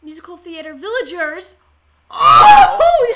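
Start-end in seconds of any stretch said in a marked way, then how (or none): 2.1-3.1 surprised shout. Real, not acted. Sudden realisation, laughing shout.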